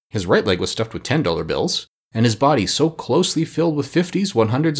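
usual speaking voice

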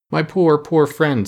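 slow, sorrowful comfort; a tin man mourning his friend, slow and tender; tender pity. Acted. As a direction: grieve over a lost friend, slowly. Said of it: "caressing it tenderly".

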